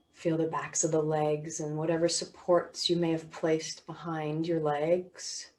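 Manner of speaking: measured body-scan voice with long pauses; guided meditation. Real, not acted. Teacher guiding listeners through body and sounds, guided meditation.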